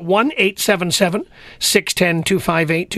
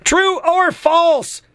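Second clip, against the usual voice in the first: high, stretched TRUE OR FALSE; drawn-out quiz call; 9 semitones higher, much slower than usual. Real, not acted. Quiz question repeated with emphasis.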